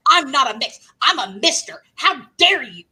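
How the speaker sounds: loud, high indignant caller; imitated outrage. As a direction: mimic a caller losing his mind. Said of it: "this guy lost his mind".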